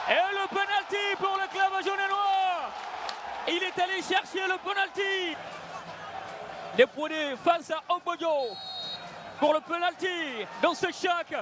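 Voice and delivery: shouted penalty and goal call; excited sports commentary. Real, not acted. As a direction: yell the goal as it goes in. Penalty and goal called live.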